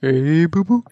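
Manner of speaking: put-on cartoon bear voice; jokey cartoon bear impression; Yogi Bear. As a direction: greet a little pal as a goofy cartoon bear. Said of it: "No, it's Yogi Bear".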